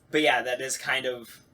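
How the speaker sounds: ordinary talk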